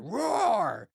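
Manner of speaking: big, loud, high mock lion roar. Comedian playing his wife's lion, loud mock roar. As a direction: let out a hammed-up lion roar. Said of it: "the way he did that had me in tears".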